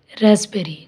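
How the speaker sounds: usual speaking voice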